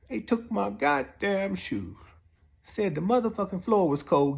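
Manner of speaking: ordinary talk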